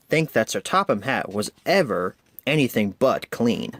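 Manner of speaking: ordinary delivery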